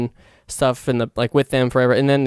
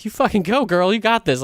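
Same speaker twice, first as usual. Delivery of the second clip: higher-pitched, playful hype; cheering her on; 6 semitones higher than usual. Retold or imitated. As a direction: egg her on like a supportive fan. Acting out cheering on a singer.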